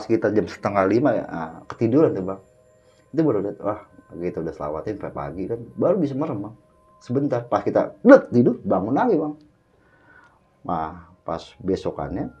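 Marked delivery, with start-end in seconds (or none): none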